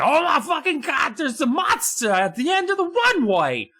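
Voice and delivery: high, hammed-up panicked kid shriek; a boy freaking out over a monster, in a high yelp; mock panic. Jordan sees a monster on the runway, squealing in mock terror. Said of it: "But he's like".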